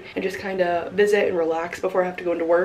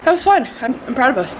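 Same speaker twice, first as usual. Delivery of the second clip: excited; 6 semitones higher, 3 dB louder than usual. Real, not acted. Excited after racing the tree.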